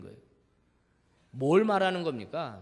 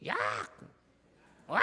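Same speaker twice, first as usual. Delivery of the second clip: imitating a mother shouting at her kid; mock mother's yell; 7 semitones lower, 3 dB quieter, faster than usual. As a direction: call the child like an angry mom. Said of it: "우리 엄마들은 어떻게 하죠?". (엄마의 호통 흉내)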